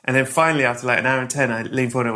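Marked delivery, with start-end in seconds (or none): none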